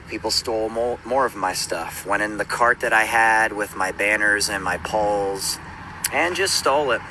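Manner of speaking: ordinary talk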